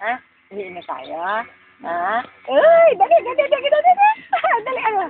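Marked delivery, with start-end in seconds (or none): 2.5-5.0 very high, sing-song coaxing. Real, not acted. (mataas na boses-bata, nanunuyo)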